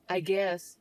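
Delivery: normal talking voice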